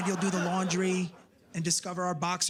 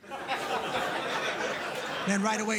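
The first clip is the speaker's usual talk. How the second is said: ordinary delivery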